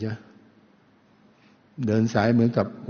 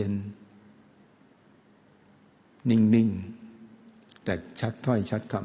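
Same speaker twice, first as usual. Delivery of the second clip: slow calm speech; much slower than usual. Shown as a demonstration. Speaker showing how grandma speaks, slow and calm. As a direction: let each word settle, showing grandma's calm. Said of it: "ไม่ได้พูดแบบเร่งๆ พูดแบบเย็นๆ".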